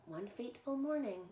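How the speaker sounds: ordinary delivery, nothing marked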